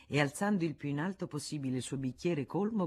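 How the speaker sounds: ordinary delivery, nothing marked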